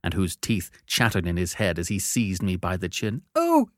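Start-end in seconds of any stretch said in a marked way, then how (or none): 3.3-3.7 put-on high, loud terrified child; pleading in terror. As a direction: beg for your life, high-pitched. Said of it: "I pleaded in terror".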